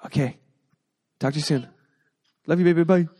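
usual speaking voice

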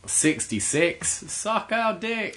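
slow, sing-song cheeky bingo call; bingo caller. Retold or imitated. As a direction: belt out a rude bingo call. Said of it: "like, teach us stuff".

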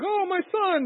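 high-pitched, hammed-up fatherly cry; mock anguished father. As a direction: imitate a father calling out for his son. Voices father crying out to son.

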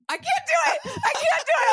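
high, laughing squeaky take on him; mock his flustered voice, rising; imitating boyfriend. Imitating her flustered boyfriend, high and laughing. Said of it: "He'll be like".

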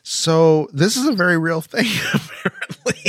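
loud, high, laughing through the words; breaking into laughter. Real, not acted. Husband cracking up after his mock-tearful story, laughing. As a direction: lose the solemn act and crack up.

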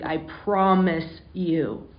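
normal talking voice

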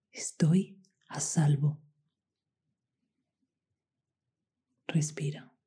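whispered calm affirmations; guided meditation. Acted. Affirmations and breath cues.

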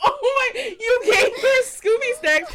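loud, high, laughing disbelief; laughing shout. Retold or imitated. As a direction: yell it while cracking up. Retold shocked yell, laughing.

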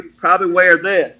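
ordinary talk, nothing marked

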